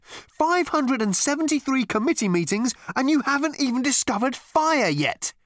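high, incredulous outburst; exasperated exclamation. A narrator's reading. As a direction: exclaim at the committee's uselessness. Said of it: "he exclaimed".

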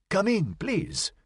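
put-on high, soft, slow old lady's plea; soft high-pitched Madame urging a guest in; insistent elderly Frenchwoman. A narrator's reading. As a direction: insist gently in a high, drawn-out voice. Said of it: "Madame Parmentier insisted".

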